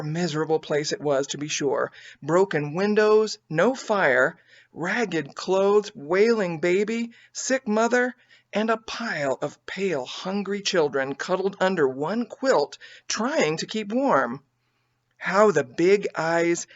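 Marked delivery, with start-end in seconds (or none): none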